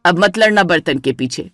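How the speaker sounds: angry mother-in-law. Acted. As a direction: scold both daughters-in-law from a low voice. (गुस्से में सास की भारी आवाज़)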